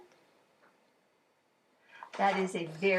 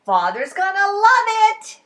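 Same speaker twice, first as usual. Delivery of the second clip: cheerful; 11 semitones higher, 14 dB louder than usual. Acted. Ted cheerfully sure.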